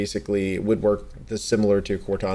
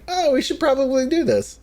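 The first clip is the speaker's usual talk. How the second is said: much higher, mock clueless voice; mock dim realization. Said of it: "they're just now going".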